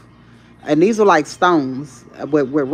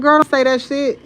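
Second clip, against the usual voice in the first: put-on scolding her child; 10 semitones higher, 4 dB louder than usual. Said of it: "I said".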